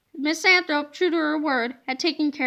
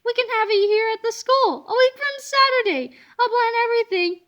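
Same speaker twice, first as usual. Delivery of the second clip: higher, eager voice; excited teacher rushing ahead; gushing; 5 semitones higher than usual. A narrator's reading. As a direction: gush like a busybody planner. Miss Anthrope gushing plans.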